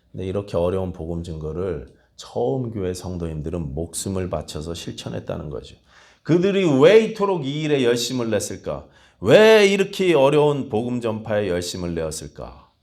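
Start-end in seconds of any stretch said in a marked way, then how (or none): 6.2-12.7 loud, raised preaching voice; full-force preaching. Acted. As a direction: preach it with rising fervor. Rhetorical questions to congregation. (힘있게 외치는 설교 목소리)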